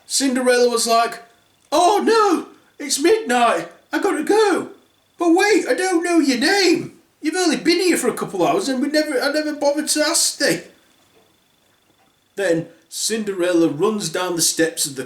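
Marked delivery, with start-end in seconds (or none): 1.7-10.6 high, loud flustered cry; panicked dash. Acted. Cinderella and prince panicking at midnight, high and loud. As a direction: squeal it in a rush.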